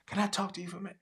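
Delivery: soft worker asking for a word; hushed church worker. Retold or imitated. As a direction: quietly pull the parent aside. Church worker voiced quietly.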